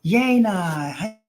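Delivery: normal talking voice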